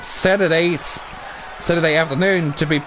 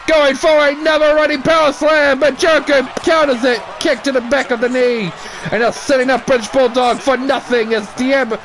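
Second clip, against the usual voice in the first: excited play-by-play; 7 semitones higher than usual. Acted. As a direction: sell every near-fall to the crowd.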